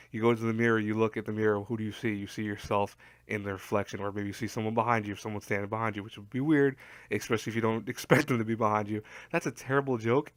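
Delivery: usual speaking voice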